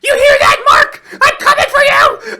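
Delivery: shrieking laugh-cry at the top of the voice; high manic shrieking threat; manic scream. Acted. Gun threatens Mark, screaming.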